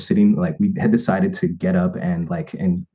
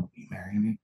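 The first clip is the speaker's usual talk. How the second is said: much softer, tender and hushed; hushed earnest question; 11 dB quieter than usual. Retold or imitated. The proposal question itself, soft and earnest. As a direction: ask it softly, centered and sincere. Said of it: "center my entire being and then ask".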